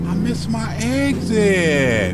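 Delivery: high, slow, drawn-out whine on "exit"; stretched frustrated lament. Real, not acted. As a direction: moan it in disbelief. Missed exit again.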